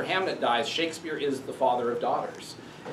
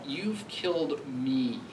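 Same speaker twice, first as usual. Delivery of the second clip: "me" said softer and slow at the end; stress on me; 6 dB quieter, slower than usual. Shown as a demonstration. Lecturer shows where the father puts the weight, stress on me. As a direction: let "me" land quiet and drawn out. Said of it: "Notice the stress on me here".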